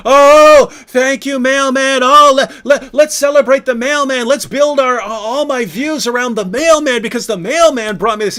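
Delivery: high, loud, hammed-up gratitude; mock gushing. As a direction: overdo the thanks to the mailman. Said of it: "you don't sit there and say".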